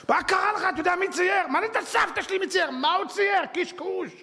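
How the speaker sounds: incredulous rant; exasperated scolding. Real, not acted. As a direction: berate him for being fooled. Continues scolding the art buyer.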